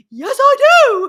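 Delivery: high, loud mimicked one-liner; hammed-up insistence. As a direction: belt it out as a cocky comeback. Re-enacting Colin's favourite one-liner.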